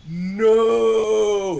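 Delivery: long, high, loud mock scream; drawn-out melodramatic cry of doom; hammy dying villain's scream. A narrator's reading. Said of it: "he screamed".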